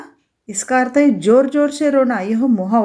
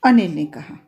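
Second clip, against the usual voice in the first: ordinary delivery, nothing marked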